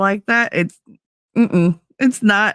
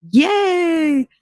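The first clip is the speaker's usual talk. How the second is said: excited cheer; 12 semitones higher than usual. Real, not acted. Greeting a viewer arriving.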